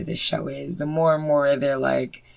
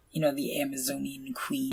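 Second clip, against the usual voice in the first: whisper; 2 semitones lower, 13 dB quieter than usual. Real, not acted. Voice drops to a whisper.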